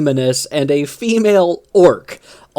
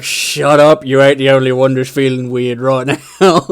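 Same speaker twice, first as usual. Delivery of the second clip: louder gruff orc snap; grumpy war boss; 5 dB louder than usual. Retold or imitated. War boss cutting off his orc, gruff and loud. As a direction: brush him off, rattled himself. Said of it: "the boss is like".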